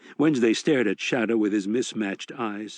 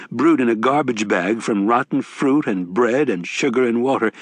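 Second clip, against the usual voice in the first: ordinary delivery, nothing marked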